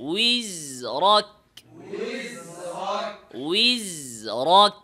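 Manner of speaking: উইজরক stretched very slowly with a rising end; drawn-out demonstration of উইজরক; slow drill. Shown as a demonstration. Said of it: "teacher drills slowly". (খুব ধীরে টেনে উইজরক)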